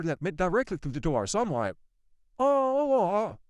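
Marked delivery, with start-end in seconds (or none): 2.4-3.4 drawn-out high "oh"; retold dawning realization, pitched up; mock realization. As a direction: let the oh swoop high, knowingly. Acts out realizing it got out.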